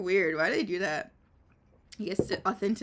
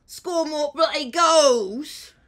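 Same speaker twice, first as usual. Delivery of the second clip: loud, slow British hooligan roar; football hooligan; 6 semitones higher, 10 dB louder, much slower than usual. Shown as a demonstration. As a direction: bellow it like a football fan, high and drawn out. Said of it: "you do the football hooligan".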